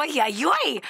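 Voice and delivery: ordinary delivery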